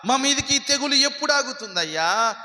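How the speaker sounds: preacher acting out a desperate lament; imitated wailing plea. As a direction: plead loudly on a high pitch, as if lamenting. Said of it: "బట్టలు చింపుకొని ఏడ్చారు".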